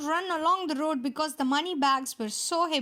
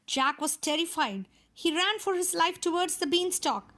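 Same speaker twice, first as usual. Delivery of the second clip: ordinary delivery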